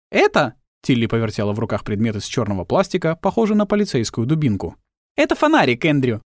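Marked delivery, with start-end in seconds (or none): 0.1-0.6 put-on much higher, louder girlish greeting; Tilly voice. 5.2-6.2 Tilly voice. Acted.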